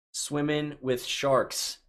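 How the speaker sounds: Swimming with sharks stretched out very slowly; slow drawn-out repeat. Shown as a demonstration. Answering a fan's bucket-list question, slow and savoring. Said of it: "What's on my bucket list?".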